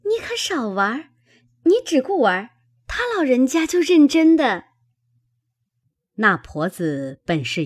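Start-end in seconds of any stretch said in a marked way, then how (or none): 0.0-4.6 put-on high, quick girlish chiding; good-humoured chiding. A narrator's reading. Chunyan warning her friend to stop joking, good-humoured high chiding. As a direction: lightly scold a joking friend, pitched high. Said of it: "春燕笑道".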